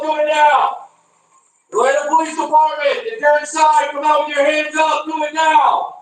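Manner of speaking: ordinary delivery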